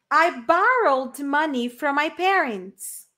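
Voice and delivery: slow, clear English model; slow model sentence. Shown as a demonstration. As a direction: read it slowly. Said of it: "Number four". (frase modelo lenta)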